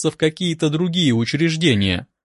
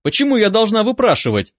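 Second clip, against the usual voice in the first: higher, mildly indignant question; indignant protest; 6 semitones higher than usual. A narrator's reading. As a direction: protest with a touch of anger. Angry patient argues with doctor.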